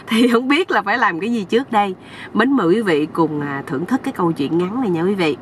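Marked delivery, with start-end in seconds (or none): none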